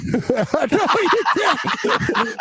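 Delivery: high, giggling repeat; laughing echo. Real, not acted. Co-host repeating the punchline through laughter, laughing.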